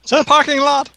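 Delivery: high, heroic call to action; mock rallying cry. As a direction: call the party to flee. Players rally to flee.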